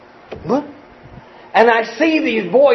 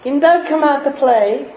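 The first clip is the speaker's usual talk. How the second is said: mock innocent devil; 6 semitones higher than usual. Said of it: "the devil will say".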